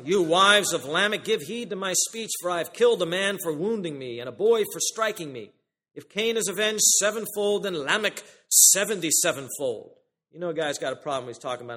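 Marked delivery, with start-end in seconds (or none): 0.0-10.0 louder, boastful declaration; pompous Lamech. Retold or imitated. Lamech boasting to his wives, loud and pompous. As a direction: swagger through the threat like a braggart. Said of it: "this guy's got a big head".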